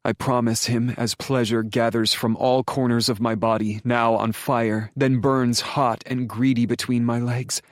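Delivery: ordinary delivery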